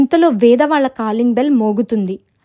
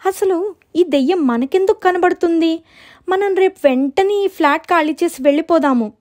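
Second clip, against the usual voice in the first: high, complaining voice; acted anxious girl, pitched up; fretful little sister; 8 semitones higher than usual. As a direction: plead to get away from the ghost. Frightened sister wants to flee.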